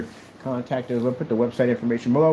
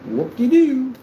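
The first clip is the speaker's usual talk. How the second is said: high, loud whoop; mock cheer; 11 semitones higher, 6 dB louder, slower than usual. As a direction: cheer it with sing-song glee. Sarcastic cheer over product spec.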